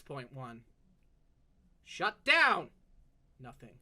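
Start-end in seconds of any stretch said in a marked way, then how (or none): 1.8-2.7 loud, higher order; forceful command. Acted. Elf orders the robot to shut down, loud. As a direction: bark the command.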